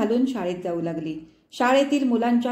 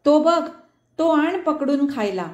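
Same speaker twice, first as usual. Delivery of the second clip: higher, louder call from the window; excited shout; 6 semitones higher, 3 dB louder than usual. A narrator's reading. Girl at the window calls out about the frog, shouting. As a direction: call out eagerly at the frog in the rain. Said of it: "ओरडली".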